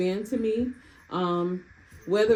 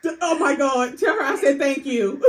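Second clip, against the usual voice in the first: laughing excited; 5 semitones higher, 5 dB louder than usual. Real, not acted. Niece yelling congratulations.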